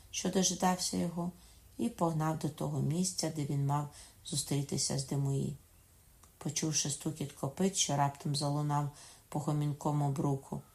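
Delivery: ordinary delivery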